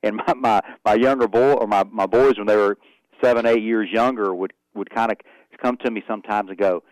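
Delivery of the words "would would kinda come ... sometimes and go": usual speaking voice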